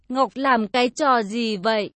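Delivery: usual speaking voice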